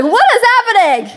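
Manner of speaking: hammed-up fright; mock scared. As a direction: play a panicked parent woken at night. Imagined startled reaction to intruder.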